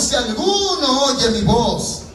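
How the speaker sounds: high, ringing scripture proclamation; impassioned preacher, high and insistent; full-force preaching. Acted. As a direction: proclaim the verses from the pulpit.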